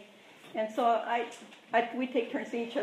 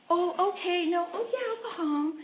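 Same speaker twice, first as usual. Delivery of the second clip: higher, sweetly compliant on the surface; passive aggressive; 7 semitones higher, 3 dB quieter than usual. Retold or imitated. Agreeing to go home while seething, sugary okay. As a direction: hide the anger behind a pleasant okay. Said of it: "because I'm passive aggressive".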